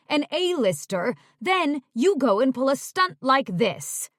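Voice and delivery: loud, exasperated tirade; angry scolding. Acted. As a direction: lay into her, loud and fed up. Agent scolding client.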